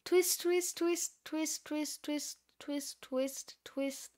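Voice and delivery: soft, small repeated effect; hushed, evenly repeated little noises; spoken sound effect. Acted. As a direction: murmur it rhythmically, fading away. Softly voiced twisting sound effect.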